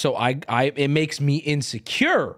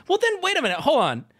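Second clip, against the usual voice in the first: sudden realisation; 13 semitones higher than usual. Real, not acted.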